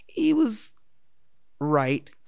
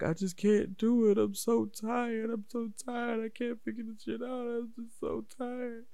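imitating tearful whining; 10 semitones higher, 5 dB quieter than usual. Retelling his younger self begging the coach, mock tearful. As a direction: whimper it out like a worn-out kid. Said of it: "I was just crying and crying".

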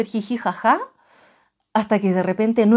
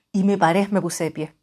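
ordinary talk, nothing marked

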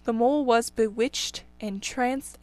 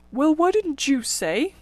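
higher, slow, indignant rabbit; grumbling rabbits; 4 semitones higher, 4 dB louder than usual. Acted. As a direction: snap back in slow complaint. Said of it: "they all started grumbling".

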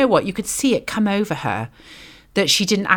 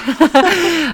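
laughing; 11 semitones higher, 6 dB louder than usual. Real, not acted. Laughs at own joke.

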